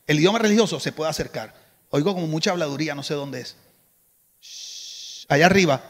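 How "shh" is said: long soft shush; drawn-out "shh" for silence. Real, not acted. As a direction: hush the noisy people upstairs with a "shh". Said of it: "necesito que hagan silencio allá arriba".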